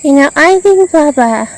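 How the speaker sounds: high, loud mocking parody of nagging housemates. Mocking the housemates who nag him, in a high, loud put-on voice. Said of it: "I hate hearing them nag".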